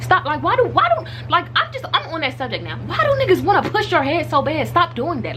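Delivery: high-pitched, worked-up complaint; fed-up, high-pitched venting; exasperated rant. Real, not acted. Exasperated rant about head pushing.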